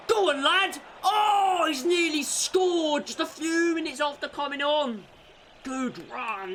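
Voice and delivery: high, loud commentary yell at a near miss; excited yell. Real, not acted. Fan commentator yelling as his debut signing nearly scores. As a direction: leap up yelling at the chance.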